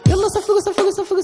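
very high, loud call to clap; excited call. Acted. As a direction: cheer the kids on to clap. Urging kids to clap. (نداء متحمس عالي)

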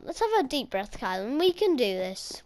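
usual speaking voice

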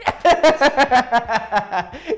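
loud, high burst of laughter; real helpless cackling; big real laugh. Real, not acted. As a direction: crack up at your own impression. Laughs at own imitation.

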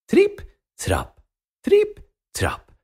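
high, tiny tripp trapp; dainty little-goat steps on the bridge; high light footsteps. Acted. Smallest goat crossing the bridge.

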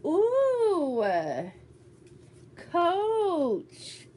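high, loud excited ooh over the Coach gift; delighted squeal. Real, not acted. Unboxing a gift from Linda, delighted high squeal. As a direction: squeal with joy at the box.

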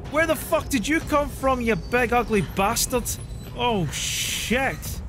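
high, shocked swearing at the monster; real startle; alarmed shout. Real, not acted. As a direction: blurt it out in real fright. Monster suddenly appears in game.

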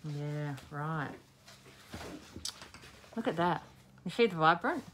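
talking around a paintbrush in the mouth. Real, not acted. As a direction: speak with a brush between your teeth. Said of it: "that's me talking with a paintbrush in my mouth".

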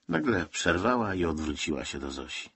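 plain reading voice

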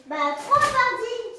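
high, very loud, drawn-out answer; loud guess. Acted. Parent answers the child's number game, loudly. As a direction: belt the guess out slowly.

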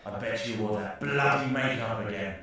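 ordinary talk, nothing marked

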